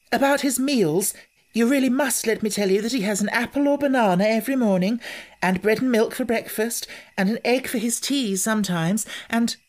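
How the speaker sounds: lightly voiced young girl, eager and hurried; a hint of an anxious girl rushing her point; girl's voice. Anthea explaining the baby's meals, lightly voiced girl.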